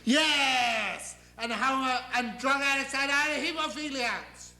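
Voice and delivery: high, emphatic ranting deity; God. Acted. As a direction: declare it with gleeful outrage. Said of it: "And suddenly he says".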